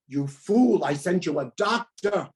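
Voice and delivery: put-on a Yiddish-accented punchline, exasperated. God chides the praying old man, in a light Yiddish accent. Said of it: "I know that's a Yiddish accent".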